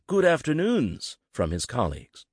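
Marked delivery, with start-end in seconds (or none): 0.1-1.2 much higher, drawn out slowly in mockery; mocking good afternoon. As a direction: tease the late colleague with a sing-song greeting. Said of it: "a chorus of mocking".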